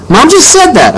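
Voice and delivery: very high, accusing protest; indignant. A narrator's reading. Boy pointing at mom in an argument, indignant. Boy arguing back at parents.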